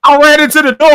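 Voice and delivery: high, loud imitated excuse; mock wailed excuse. Retold wife's excuse.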